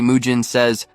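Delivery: ordinary delivery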